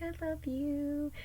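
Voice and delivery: high, soft and slow; sweet I love you. Retold or imitated. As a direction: murmur it fondly to the cat. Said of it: "I'm being all sweet with her".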